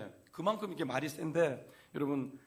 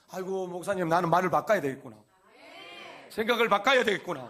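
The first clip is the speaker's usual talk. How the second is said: resolved listener; 8 dB louder than usual. Retold or imitated. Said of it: "이렇게 듣는 사람이 있을 수 있고".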